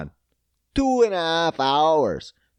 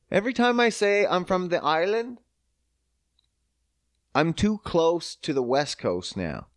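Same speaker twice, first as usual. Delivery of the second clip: ordinary talk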